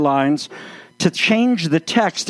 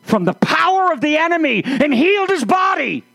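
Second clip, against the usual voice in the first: higher, awed voice of Jesus proclaiming God's power; reverent proclamation; 7 semitones higher than usual. Acted. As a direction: announce the miracle with holy wonder.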